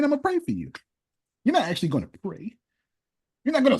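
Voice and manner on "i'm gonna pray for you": much higher, sing-song mock of the empty promise; mock the hollow promise; mocking quote. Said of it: "You're just saying".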